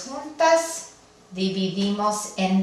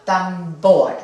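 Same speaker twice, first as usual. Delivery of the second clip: "tambor" with a loud, firm BOR; stress on last syllable; 5 dB louder than usual. Shown as a demonstration. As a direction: say "tambor" as tam-BOR, leaning on the end. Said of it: "la sílaba tónica debería ser la última". ("tambor" con el acento en la última sílaba)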